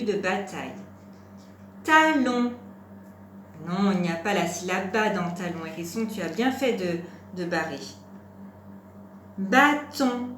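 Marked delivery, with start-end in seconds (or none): 1.8-2.5 loud, high, deliberate model word; raised, projected teacher's dictation. Shown as a demonstration. Teacher dictates word for syllable listening. 9.4-10.3 loud, high, deliberate model word; raised, projected teacher's dictation. Shown as a demonstration. Teacher dictates word for syllable listening.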